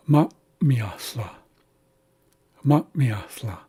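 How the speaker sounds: "ma, miasla" with a brief held breath after "ma"; glottalized M. Shown as a demonstration. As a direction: cut the airflow after "ma", then say "miasla". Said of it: "a slight pause before this second M".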